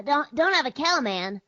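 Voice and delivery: high cartoon boy voice; Bart Simpson. Shown as a demonstration. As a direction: toss off the catchphrase as a cartoon kid. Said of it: "a pretty decent Bart Simpson".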